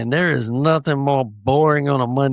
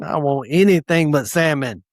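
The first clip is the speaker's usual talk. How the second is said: ordinary delivery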